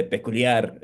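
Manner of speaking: peculiar said the Spanish way; Spanish pronunciation. Shown as a demonstration. Showing the name works across languages, peculiar in Spanish. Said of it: "in Spanish".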